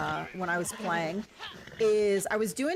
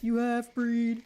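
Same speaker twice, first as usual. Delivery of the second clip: 1 slow mocking echo; 2 semitones higher, much slower than usual. Echoing NPC insult.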